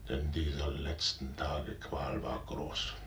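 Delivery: müde geflüstert, fast tonlos; erschöpftes Flüstern. Acted. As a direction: lass die Qual im Flüstern verklingen. Müder Abschied vor dem Schlaf. (leise und erschöpft geflüstert)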